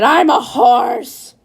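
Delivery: high, drawn-out panicked yell; screaming. A narrator's reading. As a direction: shout it loud and high, stretching the words. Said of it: "was screaming".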